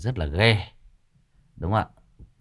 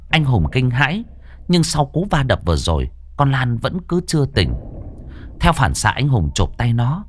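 steady storyteller reading a ghost tale; story narration; 7 semitones higher than usual. A narrator's reading. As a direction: tell it like a spooky radio story. Reads horror story narration. (giọng kể chuyện ma)

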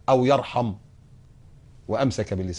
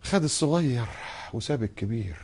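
bitter grumble; 6 dB quieter than usual. Retold or imitated. Mock complaint about God.

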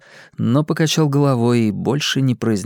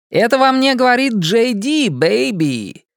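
James Dean impression; 11 semitones higher than usual. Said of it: "неубедительную имитацию Джеймса Дина".

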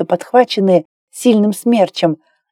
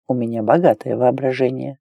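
low, softer man's reply; lightly voiced male lover; 6 semitones lower, 5 dB quieter than usual. A narrator's reading. Lover replies to Claire's teasing, low soft male voice. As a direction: answer low and easy.

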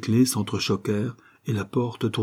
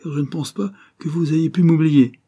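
higher-pitched, emphatic exclamation; Sherlock Holmes; 7 semitones higher, 4 dB louder than usual. A narrator's reading. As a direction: exclaim it to jog his memory. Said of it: "s'écria Sherlock Holmes fort à propos".

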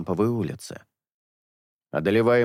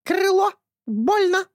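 high loud squawking bird; complaining parrot; 4 dB louder, slower than usual. A narrator's reading. (жалобный птичий крик)

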